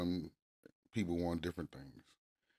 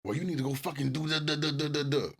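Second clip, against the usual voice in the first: higher, fast cursing rant; mock hollering rant; 8 semitones higher, 4 dB louder than usual. Husband imitating himself ranting at his wife, fast higher rant. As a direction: rattle it off like mid-argument. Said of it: "I'm still cursing".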